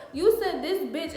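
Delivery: high, loud accusation, acted out; retold blow-up. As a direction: go off on him, reenacting it. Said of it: "So I automatically kirk".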